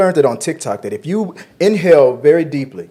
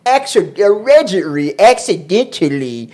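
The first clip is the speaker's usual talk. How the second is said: raised, drawn-out ACCIDENTALLY in mock quotation marks; air-quoted; 5 semitones higher than usual. Host copies wife's air quotes, pointedly sceptical. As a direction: say ACCIDENTALLY higher and slower, as if quoted. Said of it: "Joy put that in air quotes".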